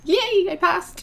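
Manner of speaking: high, slow, sing-song yay; excited cheer. Real, not acted. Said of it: "I'm so excited".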